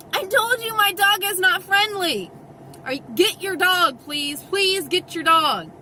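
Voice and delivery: exasperated retold plea. Owner separating two dogs, telling the stranger, exasperated plea. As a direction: plead loudly as patience runs out.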